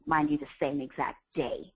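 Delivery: ordinary delivery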